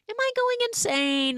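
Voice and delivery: high, strained self-questioning; acting out rising alarm; mock panic. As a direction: voice leaps up as if losing it. Retold heroine doubting her own sanity.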